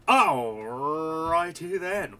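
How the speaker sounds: very slow, stretched "Alrighty then"; long playful opener; drawn-out catchphrase. Pretend. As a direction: savour the catchphrase before starting. Catchphrase repeated as show start.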